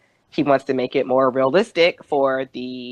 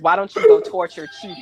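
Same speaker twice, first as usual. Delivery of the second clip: louder, higher eager suggestion; Michael deflecting; 5 semitones higher, 6 dB louder, faster than usual. Retold or imitated. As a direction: push the idea brightly. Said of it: "Michael says".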